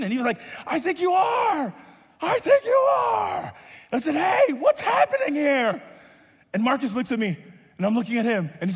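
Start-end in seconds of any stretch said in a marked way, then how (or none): 0.7-3.5 high, loud-pitched mock yell, stretched on the repeat; mock shouting. Imitating sister yelling an answer to the player, mock shouting. 4.1-5.8 higher, slow, drawn-out challenge; indignant calling out. Retold or imitated. Coach interrupts player consulting his sister, acted indignant call.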